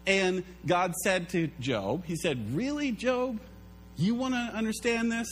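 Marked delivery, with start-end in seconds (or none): 2.6-5.3 high, mocking God asking Job; playful incredulous God voice, much higher.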